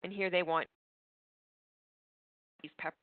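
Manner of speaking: audio cutting out; choppy broken connection; corrupted glitchy audio. Real, not acted. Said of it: "your audio is cutting out a little bit on us".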